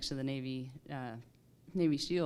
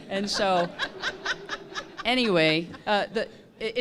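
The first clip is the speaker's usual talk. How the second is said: laughing out loud through the words; can't hold back the laugh; 4 semitones higher, 6 dB louder than usual. Real, not acted. Pilot cracking up at her own war story, laughing.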